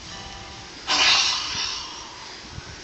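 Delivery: long, voiceless sigh after a swallow; satisfied sigh. Acted. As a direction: let out a long "ahh" of whiskey breath. Drinker's sigh after swallowing whiskey.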